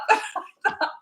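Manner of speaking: high, airless burst of laughter; squeaky silent giggle; breathless laugh. Real, not acted. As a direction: laugh helplessly with no voice. Laughing at son's offer.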